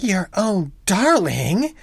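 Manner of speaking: fierce and slow, "darling" rising scornfully; wife scorning her husband's endearment, fierce. A narrator's reading. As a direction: throw his words back at him, fiercely. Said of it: "she answers very fierce through her sobs".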